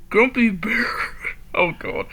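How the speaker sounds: usual speaking voice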